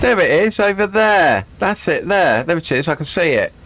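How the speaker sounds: relieved, pointing it out; excited. Real, not acted. Spotting runway in fog.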